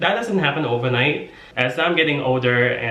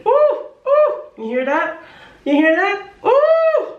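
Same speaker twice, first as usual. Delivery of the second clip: very high, hooting oohs and squeals; mock swooning; 5 dB louder than usual. Playing someone thrilled by a sexy accent, mock swooning. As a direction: squeal in hammed-up delight.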